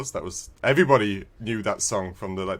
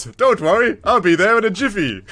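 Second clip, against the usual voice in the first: Ethan's line said higher and louder, cheerfully; mock breezy agent; 8 semitones higher, 6 dB louder than usual. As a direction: toss it off like a carefree hero. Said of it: "Ethan goes".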